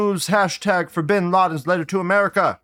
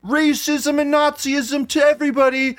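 shrill high-pitched mock panic, louder; mocking hysterical critics; 12 semitones higher, 4 dB louder than usual. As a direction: parody the pearl-clutching media. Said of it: "they would have been like".

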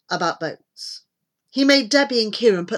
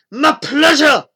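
loud, higher relish; raised thuggish reply; villain's henchman; 8 semitones higher, 10 dB louder than usual. A narrator's reading. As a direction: answer the boss with menacing willingness. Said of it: "said Deal".

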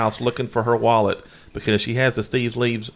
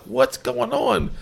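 confused; 13 semitones higher, much slower than usual. Retold or imitated. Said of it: "and I'm like".

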